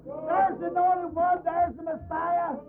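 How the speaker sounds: high fervent shouting; ecstatic shout. Acted. Bartimaeus filled with the Holy Ghost, high ecstatic shout. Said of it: "he began to scream".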